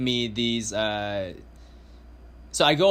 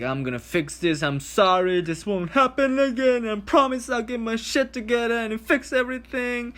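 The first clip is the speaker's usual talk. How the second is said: high, whiny mocking apology voice; hammed-up sorry-sorry voice; 10 semitones higher than usual. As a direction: parody her empty promises in a high put-on voice. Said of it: "H P V girl was all like".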